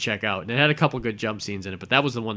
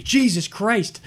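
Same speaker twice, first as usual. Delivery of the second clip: startled; 9 semitones higher, 4 dB louder, slower than usual. Retold or imitated. Startled by man at window.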